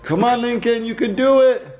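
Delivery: encouraging cheer. Real, not acted. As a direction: cheer him on, lifted and eager. Cheering toddler climbing out window.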